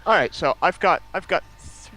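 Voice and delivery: usual speaking voice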